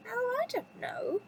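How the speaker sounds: high, slow, coaxing teapot voice; acted high-pitched housekeeper; Missus Potts. A narrator's reading. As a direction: draw it out high, hinting at a secret. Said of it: "said Missus Potts".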